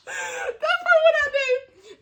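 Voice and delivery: slow, very high laughing squeal; real laughter. Real, not acted. Host amused by her made-up answer, laughing squeal. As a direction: squeeze the words out through giggles.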